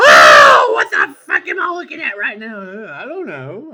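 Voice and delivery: high, loud shocked outburst; shocked yell. Acted. Mock shocked yell at the cartoon on screen. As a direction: shout it in disbelief.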